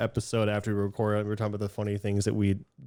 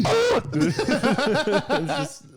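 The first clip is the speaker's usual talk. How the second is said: laughing; 3 dB louder than usual. Real, not acted. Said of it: "making myself laugh".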